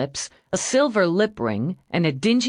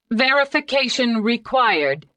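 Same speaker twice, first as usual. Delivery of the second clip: higher machine voice; computer voice; 7 semitones higher than usual. Acted. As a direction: answer like a computer. Computer replies.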